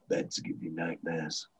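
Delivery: low, hushed, slow war veteran; scarred veteran. Acted. Quoting Nick Nolte's veteran to a co-host, low and haunted. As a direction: play up the haunted veteran, very quietly. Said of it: "playing up the veteran, scarred veteran thing".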